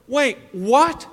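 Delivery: usual speaking voice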